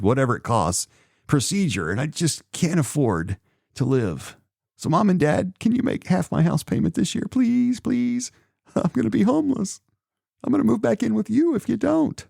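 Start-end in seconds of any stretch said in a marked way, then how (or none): 1.9-12.3 higher, pleading whine to the parents; hammed-up begging of Mom and Dad; mock whiny plea. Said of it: "gonna complain".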